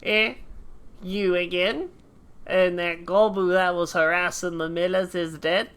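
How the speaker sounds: put-on hammy British accent drifting into Australian; muddled British-Australian character accent; struggling put-on accent. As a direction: play a gruff game veteran in a wobbly accent. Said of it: "I'm gonna die doing this accent".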